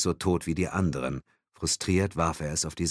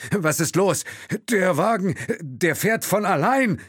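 high, loud, slowing disbelief; can't believe the car drives itself; 13 semitones higher, 5 dB louder than usual. A narrator's reading. Chauffeur cries out in high disbelief as the car drives itself. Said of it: "Will hörte Unglauben in seiner Stimme".

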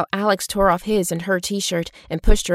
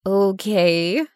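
drawn-out, slightly awkward okay. A narrator's reading. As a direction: stretch it out with mild awkwardness. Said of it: "with a slightly awkward laugh".

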